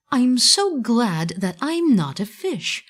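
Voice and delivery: a small wooden puppet relieved he isn't a fish; Pinocchio's glad thought. A narrator's reading.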